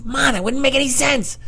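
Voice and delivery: squeaky, very high protest; high-pitched childish complaint; himself as a kid. Retold or imitated. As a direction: object to mom in a little-kid voice. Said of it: "And I'm like".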